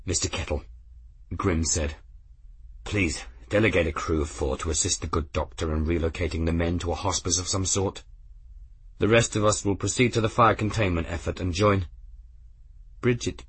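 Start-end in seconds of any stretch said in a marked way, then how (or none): 0.0-11.9 low stern captain. Acted. Captain giving low orders.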